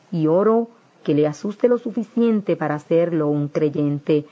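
usual speaking voice

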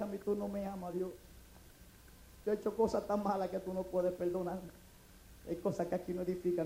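soft, low, dejected confession; quiet despair. Acted. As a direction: drop the voice, hurt and hushed. Despairing words to God outside home.

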